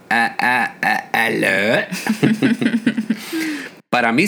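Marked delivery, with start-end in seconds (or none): 0.1-1.9 slow, stiff robotic hello. Retold or imitated. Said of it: "poner voz de robot". 1.9-3.7 high burst of laughter; cracking up at the prank. Real, not acted. Laughing at own robot prank.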